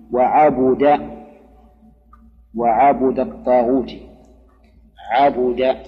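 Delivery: عبد said ʕabuda, u on the ba; fatha then damma. Shown as a demonstration. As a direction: sound عبد as ʕa-BU-da, slowly spelled out. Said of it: "فتح العين، نعم، وبضم الباء".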